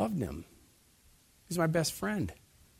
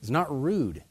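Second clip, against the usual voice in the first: ordinary talk, nothing marked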